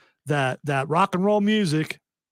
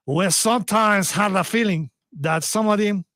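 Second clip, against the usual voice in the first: mock French accent, higher pitched; hammed-up French accent, drifting Spanish; 4 semitones higher than usual. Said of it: "it's probably more Spanish than French".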